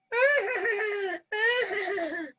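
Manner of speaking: high, repeated mimicked cackle; imitated distant laugh. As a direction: mimic a lady's laughter heard in the background. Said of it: "I hear in the background very faintly".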